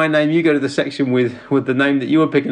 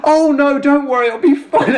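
embarrassed reassurance; 9 semitones higher, 3 dB louder than usual. Retold or imitated. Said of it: "And you're like".

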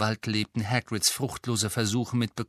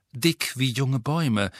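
ordinary delivery, nothing marked

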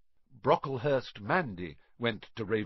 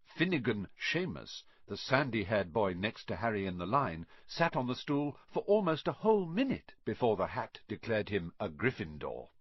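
plain reading voice

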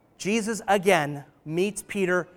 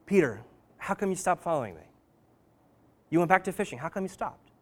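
gentle question; 2 semitones lower, 6 dB quieter, faster than usual. Retold or imitated. Jesus asking Peter why he quit, softly tender. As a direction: coax him back kindly.

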